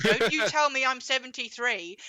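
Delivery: put-on loud, indignant old lady snapping back; mock-indignant grandma telling them off; indignant retort. Grandma snapping at the assessors, indignant and loud.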